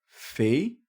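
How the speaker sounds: "Fei" set apart as one syllable; isolated syllable "Fei", diphthong kept together. Shown as a demonstration. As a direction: say "Fei" alone, then pause. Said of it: "vou separar a sílaba".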